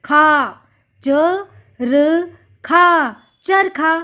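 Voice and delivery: च, र, a long drawn खा, then चरखा slowly; slow syllables. Shown as a demonstration. Teacher repeating चरखा slowly for children. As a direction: sound out चरखा slowly, stretching खा. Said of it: "इसका लंबा उच्चारण किया".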